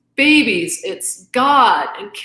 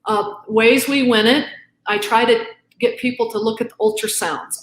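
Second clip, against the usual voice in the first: ordinary talk, nothing marked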